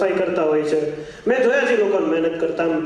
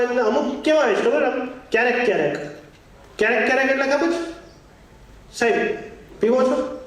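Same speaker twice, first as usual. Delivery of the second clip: normal talking voice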